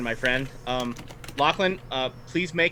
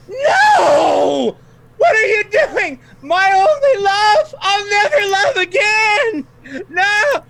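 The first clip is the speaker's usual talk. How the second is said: high, much louder shrieking lament with a cry; anguished wailing; 10 dB louder, much slower than usual. Acted. Villain lady wailing over her fallen love, anguished shout. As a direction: wail as if your only love is dying. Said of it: "and she goes".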